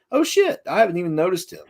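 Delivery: sudden high oh shit; surprised realization. Retold or imitated. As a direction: catch yourself off guard. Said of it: "and I was like".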